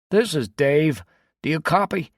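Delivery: high, loud call crackling through a radio; voice over the radio. A narrator's reading. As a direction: hail the team on the handset. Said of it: "came a voice over Connor's radio".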